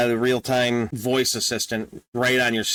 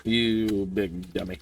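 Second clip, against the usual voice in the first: self-scolding; 3 semitones lower, 4 dB quieter, slower than usual. Real, not acted. Scolding himself over a typo.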